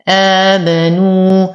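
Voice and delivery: slow chanted آمنوا with a long lengthening; recited madd. Shown as a demonstration. Teacher models the badal lengthening for Warsh reciters. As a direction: stretch the alif of آمنوا. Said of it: "يمد بثلاثة أوجه".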